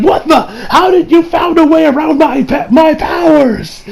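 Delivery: high acted shout of disbelief; shocked villain. Retelling Kira's dad finding his powers beaten, shocked shout. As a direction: play the thwarted villain, stretching the last words.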